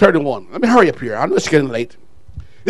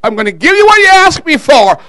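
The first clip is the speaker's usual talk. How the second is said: loud, raised preacher's voice for God; lightly acted voice of God, loud and high; God responding; 5 semitones higher, 7 dB louder than usual. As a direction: boom out a loud, quick answer. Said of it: "And God responded and said".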